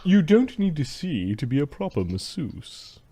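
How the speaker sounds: haughty slave master. Acted.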